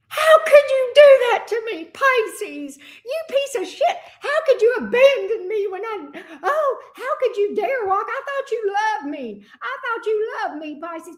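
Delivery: high, tearful mock whine of the ex; mock whiny ex. Ex voiced whining at Pisces.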